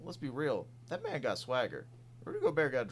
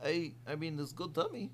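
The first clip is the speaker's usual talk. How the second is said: higher, mock-confused Russian accent; Andre Karolinko Russian accent; 7 semitones higher than usual. Asked by cohost, mock Russian baffled after dunk. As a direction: play a baffled Russian big man in a hammed-up accent. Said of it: "can you do an impression of Andre Karolinko".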